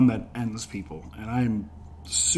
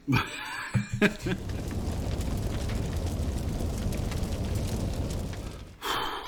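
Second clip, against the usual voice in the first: pained breathy laughter; 6 semitones higher, 8 dB quieter than usual. Real, not acted. Laughing through hot sauce pain.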